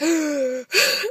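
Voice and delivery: sharp acted gasp; mock horrified gasp. Woman seeing the guy's ugly picture, mock horrified gasp. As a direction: gasp as if appalled.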